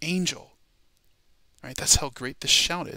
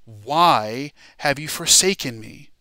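loud, slow, drawn-out cry; anguished biblical cry, acted out; thundering shout; 8 dB louder, slower than usual. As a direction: cry it out slowly, stretching the first word. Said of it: "with a loud thunder that he shouted".

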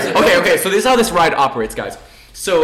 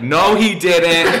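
teasing callout; 4 dB louder, much slower than usual. Retold or imitated. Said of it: "I was like".

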